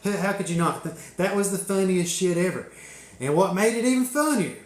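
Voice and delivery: ordinary delivery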